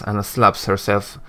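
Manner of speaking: ordinary talk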